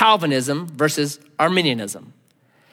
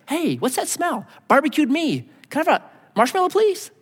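higher, fast, airy and clueless; clueless man on fire; 10 semitones higher than usual. Pretend. Man on fire asks for a marshmallow, higher and cheerfully clueless. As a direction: play a cheerfully oblivious guy asking for a treat. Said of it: "like being on fire and asking for a marshmallow".

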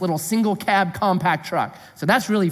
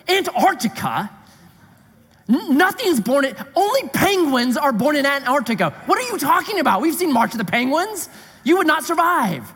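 incredulous; 7 semitones higher than usual. Retold or imitated. Incredulous retort at hitchhiker.

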